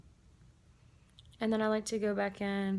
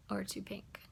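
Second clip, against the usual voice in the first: whispered aside; 12 dB quieter than usual. Real, not acted. Aside about lipstick color.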